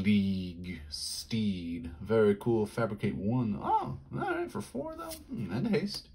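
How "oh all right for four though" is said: squeaky falsetto grumble; high squeaky voice. Pretend. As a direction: pitch it way up and squeak. High silly voice reaction.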